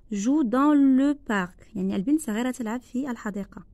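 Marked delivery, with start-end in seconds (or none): 0.1-1.8 slow, drawn out dictation; spaced classroom dictation. Shown as a demonstration. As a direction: read each word slowly for pupils. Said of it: "Je répète".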